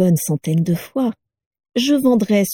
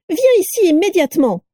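much higher sharp order; angry command; 11 semitones higher, 3 dB louder than usual. A narrator's reading. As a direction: order him back at once. Wife ordering husband.

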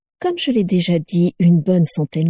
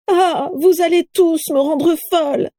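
much higher dramatic exclamation; theatrical cry; 10 semitones higher than usual. A narrator's reading. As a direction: play the fainting diva. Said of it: "s'écria Carla".

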